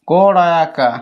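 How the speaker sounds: করায়াকা drawn out sound by sound; stretched-out spelling of করায়াকা. Shown as a demonstration. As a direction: spell করায়াকা slowly, low in pitch. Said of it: "do মানে কি".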